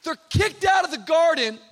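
loud, high climactic preaching; full-force preaching. Acted. Pastor preaching the fall to the congregation, full force. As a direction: drive it home to the congregation.

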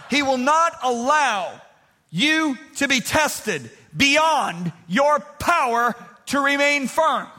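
slow, driving preacher's cry on "not allow"; preaching. Acted. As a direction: hammer the promise home from the pulpit. Preacher builds the verse.

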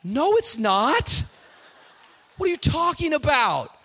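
high-pitched protest, played for laughs; mock pleading. Son begging dad not to go through with it, comic pleading. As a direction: plead like a kid dodging a spanking.

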